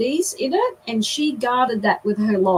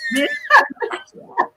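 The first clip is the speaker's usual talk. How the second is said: real laugh, pitched high. Real, not acted. Laughing at own story.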